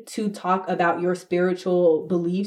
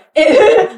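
high loud laugh; 12 semitones higher, 12 dB louder than usual. Real, not acted. Laughs at Avatar joke.

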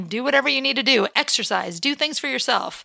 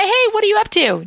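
high, lightly voiced fake-interested guy; fake good guy; 10 semitones higher, 4 dB louder than usual. Man pretending to care about her life, lightly mocked. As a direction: feign friendly interest, pitched up. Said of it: "trying to act like he's a good guy".

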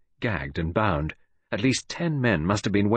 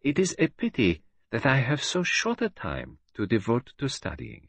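lightly voiced detective, much higher, unruffled; a hint of the captive detective asking for a smoke; calm Poirot; 7 semitones higher than usual. A narrator's reading. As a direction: stay polite and composed facing death.

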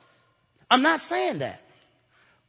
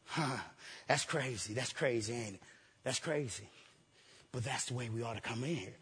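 much lower and softer musing; quiet aside; 11 semitones lower, 16 dB quieter than usual. Real, not acted. Preacher stepping back from the shout, quiet and low. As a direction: murmur it as if to yourself.

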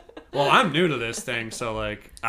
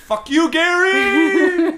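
high, slow, drawn-out shout; mock yell at seagull; 8 dB louder, much slower than usual. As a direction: yell it out at the bird, hammed up. Yelling at the seagull.

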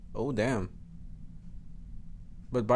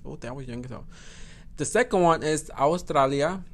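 ordinary talk

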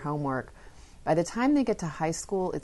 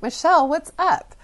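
high, loud, baffled questioning; exasperated parent; 12 semitones higher, 9 dB louder than usual. Retold or imitated. Mother to daughter refusing the conference, exasperated. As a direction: burst out as a puzzled mom.

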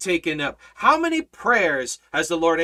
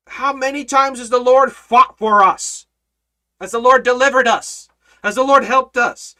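louder, driving sermon delivery; full-force preaching; 7 semitones higher, 5 dB louder than usual. Acted. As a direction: preach it to the back row.

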